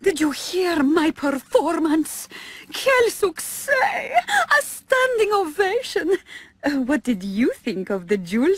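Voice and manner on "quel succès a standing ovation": triumphant diva. Acted. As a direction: crow over her success.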